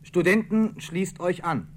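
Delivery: rallying call across to the university. Retold or imitated. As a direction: call it over the street. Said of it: "wurde hinübergerufen".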